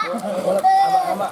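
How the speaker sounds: giggling, high and bright; laughing. Real, not acted. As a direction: laugh through the words. Laughs then says pool name high.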